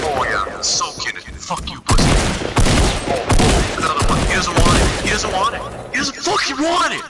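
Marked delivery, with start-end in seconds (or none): 1.3-7.0 rising excited yells; hype man yelling. Real, not acted. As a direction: build it into a scream. Hyping teammate's kills.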